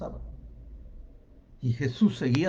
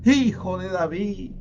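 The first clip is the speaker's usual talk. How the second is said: drawn-out call, higher; long, raised plea; shouting even harder; 4 semitones higher, 4 dB louder than usual. Acted. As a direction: cry out after someone far off. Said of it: "Más fuerte aún gritaban".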